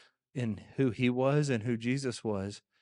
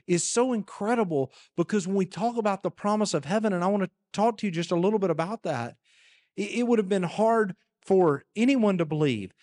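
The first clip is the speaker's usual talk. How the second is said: ordinary talk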